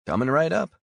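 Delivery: a chirpy diner waitress checking on a customer; friendly waitress. Acted. Waitress asks a tired customer if he's okay, friendly voice.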